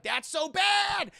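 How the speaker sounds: high, loud, slow mock retort; mock shout. Captain America shouting back at Red Skull, high slow mock shout. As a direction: bellow it out slowly and high.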